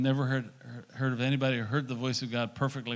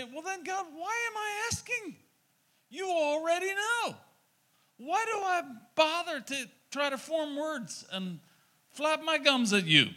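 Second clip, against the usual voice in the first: hammed-up high whine, much higher; mock whiny prayer; 12 semitones higher, 3 dB quieter than usual. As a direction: pitch it up like a fed-up petitioner. Mocking a pointless prayer.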